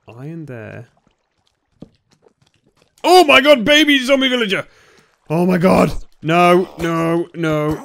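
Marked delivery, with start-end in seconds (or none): 3.0-5.9 very high, very loud alarm; panicked shout. Real, not acted. Player ambushed by a baby zombie villager, panicked shout. 6.2-7.8 loud, raised, urgent no-no-no; panicked. Real, not acted. Player fighting off a zombie villager, panicked.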